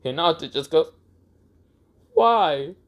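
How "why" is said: high-pitched mock-tearful question; lightly acted wounded cry; hurt, about to cry. Hinata just slapped by Naruto, tearful. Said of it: "She just starts crying".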